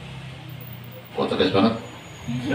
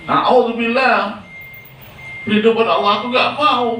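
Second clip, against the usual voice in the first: pleading earth; 10 semitones higher than usual. Acted. Earth begging the angel of death, high and frantic. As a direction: cry out to be spared.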